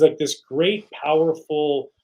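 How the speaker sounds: normal talking voice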